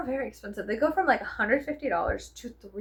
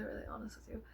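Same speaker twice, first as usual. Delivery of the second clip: a confiding hush; whispered; 15 dB quieter, faster than usual. Real, not acted. Vlogger confiding in viewers, whispered. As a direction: lean in and whisper. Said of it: "Can I be honest with you?".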